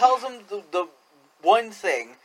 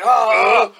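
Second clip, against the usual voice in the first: loud, higher mock groans; big exaggerated "ah" noises; imitated wrestler grunts; 4 semitones higher, 10 dB louder than usual. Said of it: "He's like".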